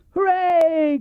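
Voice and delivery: high, loud cheer; boy shouting hooray. Acted. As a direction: cheer up at the balloon. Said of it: "Christopher Robin shouted".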